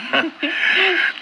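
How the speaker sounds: shy giggle. Acted. Julia giggles about her suitor.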